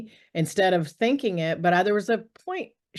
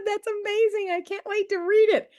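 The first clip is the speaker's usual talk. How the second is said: much higher, gushing praise; gushing friend. Retold or imitated. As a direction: imitate the friend's delight. Friend gushing over the book.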